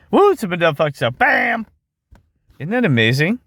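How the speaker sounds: high-pitched whoop ending in a shouted bam; thrilled, punchy exclamation; excited crowing. Real, not acted. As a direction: celebrate the find with a big punch at the end. Crowing over his on-screen discovery.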